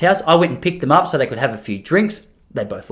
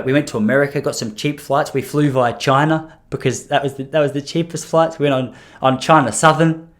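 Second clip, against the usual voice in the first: ordinary talk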